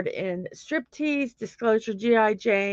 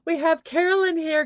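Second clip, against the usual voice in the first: excited greeting; 8 semitones higher than usual. Real, not acted. Greeting new viewer.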